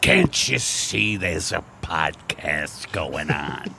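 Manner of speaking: hammed-up complaint to noisy strangers, drawn out; mock confrontation. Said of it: "I was ready to say something".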